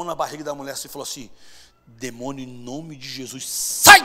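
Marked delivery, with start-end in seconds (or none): 1.9-3.5 low, soft, slow address; solemn exorcism build-up. Retold or imitated. Drummer with hand on woman's belly, low solemn command. Marcão addresses demon before shouting. 3.5-4.0 sudden high shouted order; explosive command. Retold or imitated. Drummer casting the demon out, explosive shout.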